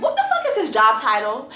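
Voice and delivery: ordinary delivery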